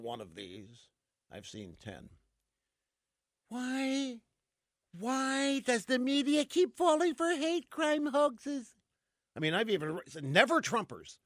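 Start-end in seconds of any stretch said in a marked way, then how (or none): 3.5-8.7 high, drawn-out plaintive mock lament; mock hand-wringing. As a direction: wail it slowly, imitating fretting intellectuals. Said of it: "are wringing their hands trying to figure out".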